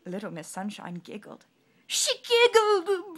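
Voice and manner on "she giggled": high, loud slow blubber; king blubbers over her giggle. Acted. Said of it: "blubbered the king".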